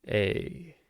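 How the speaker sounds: ordinary delivery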